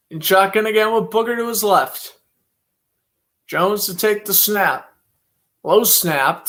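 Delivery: brisk broadcaster's call of each play; live play-by-play. Acted. Calling the football game live for stream viewers, play-by-play announcer voice. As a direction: call the snap and throw like a TV announcer.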